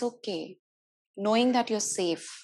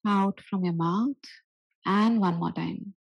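guided breathing; 3 dB louder than usual. Acted. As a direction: lead them gently through breaths. Leads breathing exercise.

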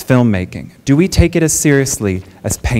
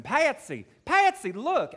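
put-on Southern accent shouting; 12 semitones higher, 10 dB quieter, slower than usual. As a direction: yell for Patsy in a thick Southern drawl. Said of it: "in his thick, thick Southern accent is screaming".

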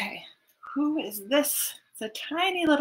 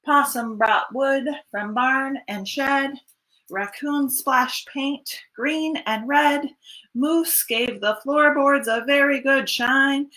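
ordinary delivery, nothing marked

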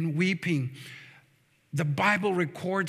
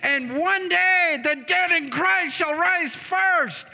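raised, high-pitched sermon delivery; impassioned preaching; 7 semitones higher than usual. Real, not acted. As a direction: preach it with rising fervour. Preaches at full force.

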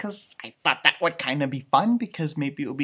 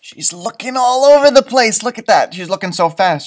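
excited narration; 5 semitones higher, 7 dB louder than usual. Acted. Reads Pam excitedly.

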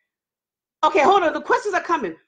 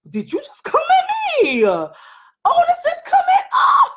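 mock outrage; 4 dB louder, slower than usual. Real, not acted. Mock outrage at Otis's joke.

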